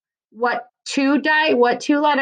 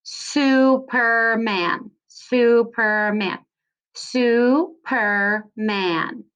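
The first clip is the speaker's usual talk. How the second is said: Superman said slowly in its three beats; slow, clapped-out Superman; syllable by syllable; much slower than usual. Shown as a demonstration. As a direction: stretch Superman out beat by beat. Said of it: "So I hear three syllables".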